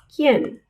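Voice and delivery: ¿Quién? with a hard k, no w; ¿Quién? as kyen, a clean k; Spanish qu. Shown as a demonstration. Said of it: "Has a k sound".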